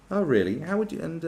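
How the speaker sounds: ordinary delivery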